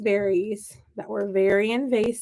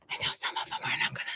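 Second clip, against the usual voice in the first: hushed, voiceless aside; mock-secret whisper; 13 dB quieter, faster than usual. Gardener keeping bad news from her seedlings, mock-secret whisper. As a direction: whisper it so the plants can't hear.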